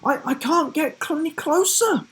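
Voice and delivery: high, fast and faltering; a girl voice backing off in a hurry; stammering girl. A narrator's reading. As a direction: stumble over the words as she dodges. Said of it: "she said".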